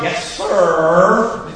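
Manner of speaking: sarcastic yes sir with a twang; twangy mocking yes sir. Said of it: "he had this twang in his voice".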